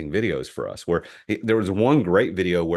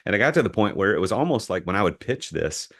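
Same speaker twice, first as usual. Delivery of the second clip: ordinary delivery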